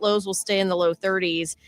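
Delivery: ordinary talk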